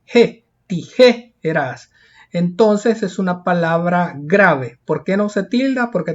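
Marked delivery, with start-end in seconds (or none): 0.0-1.9 JE alone, then tijeras slowly broken after a strong JE; stress on JE. Shown as a demonstration. Teacher pointing out the strongest syllable, stressed JE. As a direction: isolate and lengthen the JE of tijeras. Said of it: "lleva la mayor fuerza de voz en".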